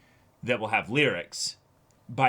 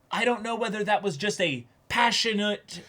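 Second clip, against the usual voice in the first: much higher, lightly put-on cautious voice; voicing Mafuyu; 11 semitones higher, 4 dB louder than usual. Host voicing Mafuyu's careful thoughts, higher put-on voice. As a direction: pitch up into a careful, tentative Mafuyu. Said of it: "Mafuyu has to be careful and be like".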